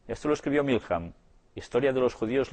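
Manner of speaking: usual speaking voice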